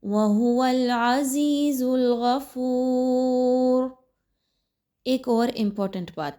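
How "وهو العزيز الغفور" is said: slow melodic tajweed chant; Quran recitation. Shown as a demonstration. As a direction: recite it slowly, drawing out the vowels. (تجوید کے ساتھ آہستہ تلاوت)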